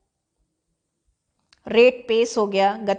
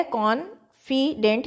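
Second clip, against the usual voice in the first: "Confident" slowly as con... fi... dent; split into syllables; 3 semitones higher, much slower than usual. Shown as a demonstration. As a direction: break "confident" into three separate syllables. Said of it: "ये syllable कहलाते हैं". ("Confident" को कॉन-फि-डेंट, रुक-रुक कर)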